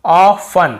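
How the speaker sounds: Often pronounced with no T, loud; silent T. Shown as a demonstration. Teacher models Often where T is not read, silent T. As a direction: say Often firmly, dropping the T. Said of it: "यहाँ पर भी टी".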